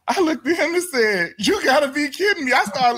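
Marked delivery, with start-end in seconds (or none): 1.4-2.5 high-pitched retold disbelief; incredulous. Retelling his reply to the officer's deal, incredulous. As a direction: act out scoffing at an absurd offer.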